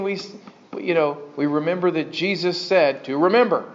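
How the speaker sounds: ordinary talk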